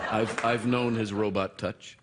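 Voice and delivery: normal talking voice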